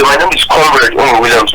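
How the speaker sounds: ordinary talk, nothing marked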